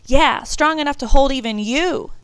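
put-on high, loud teasing boy; eager chime-in. A narrator's reading. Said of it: "David chimed in".

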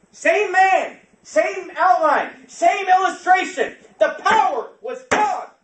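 high, slow, full-force pulpit shout; shouted preaching. Real, not acted. Preacher telling of preachers who lost power, shouted preaching. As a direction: cry out each phrase, drawn out and very loud.

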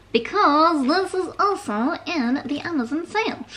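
silly high, wobbly put-on voice; weird voice. Real, not acted. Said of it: "that weird voice".

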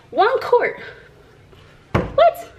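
high, loud disbelief; outraged surprise. Real, not acted. Mocking the tiny quart, high and loud. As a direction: shoot up in disbelief.

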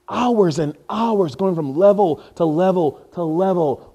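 loud, slow, drawn-out list; slow mocking list of levels; slow, mock weary. Real, not acted. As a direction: drag out each level. Mocking endless gaming.

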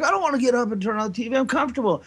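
high, quick bickering protest; whining sibling. Retold or imitated. Kid arguing with his brother over the TV, whining refusal. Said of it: "we always got in fights".

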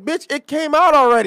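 loud, high exasperated outburst; acted fed-up shout; exasperated retort. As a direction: yell it at the overexcited fans. Acted retort to [name] fans.

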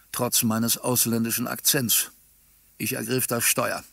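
usual speaking voice

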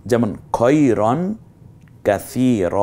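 usual speaking voice

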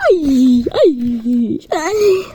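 loud hammed-up wails of ay; mock crying. Streamer pretending to cry to viewers, mock crying. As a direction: fake sobbing for laughs.

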